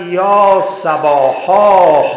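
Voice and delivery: loud call. A narrator's reading. The Prophet on Mount Safa calling the people, drawn-out loud cry. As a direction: sing out the alarm call slowly and loudly. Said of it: "و فریاد زد".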